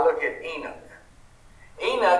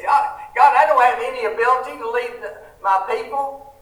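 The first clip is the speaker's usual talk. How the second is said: higher, louder, hurried protest of unworthiness; Moses pleading; 6 semitones higher, 5 dB louder than usual. Retold or imitated. Moses begging God to send someone else, higher and louder. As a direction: plead like a man who feels unable. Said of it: "Moses says".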